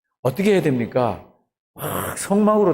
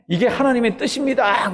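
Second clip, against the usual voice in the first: prophet's proclamation; 7 semitones higher than usual. Acted. Prophet declaring God's will to the people, high proclamation. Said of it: "그러고 막 공포를 해야 되잖아요".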